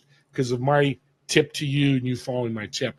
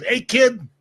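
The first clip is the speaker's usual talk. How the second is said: higher, louder call of a little Italian guy; 8 semitones higher, 5 dB louder than usual. Retold or imitated. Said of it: "Little Italian guy goes".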